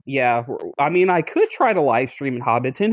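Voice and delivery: ordinary talk, nothing marked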